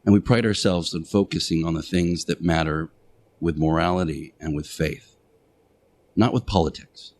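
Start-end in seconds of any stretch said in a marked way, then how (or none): none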